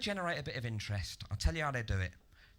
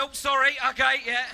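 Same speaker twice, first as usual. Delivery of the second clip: loud, high mock-apologetic outburst; mock apology; 8 semitones higher, 9 dB louder than usual. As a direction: blurt an exaggerated sorry, pitched up. Acted mock apology to the ravers.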